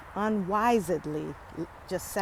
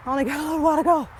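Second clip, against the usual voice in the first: out of breath; 7 semitones higher, 4 dB louder, much faster than usual. Real, not acted. Panting near the top.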